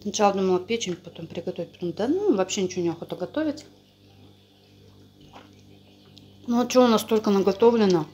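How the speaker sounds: talking between bites of dumplings; muffled chewing speech; mouth full, chewing. Real, not acted. Seen 2: Woman bites dumplings at 3.8s, chews with cheek bulging throughout.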